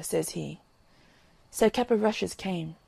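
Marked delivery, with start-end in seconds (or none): none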